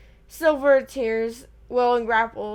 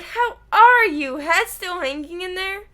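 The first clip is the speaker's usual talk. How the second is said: hearty roar; 6 semitones higher, 3 dB louder than usual. A narrator's reading. He roared.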